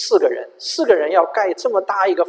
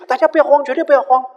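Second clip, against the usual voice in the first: urgent plea; 9 semitones higher, 6 dB louder, faster than usual. Retold or imitated. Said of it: "他又要保持镇静的样子".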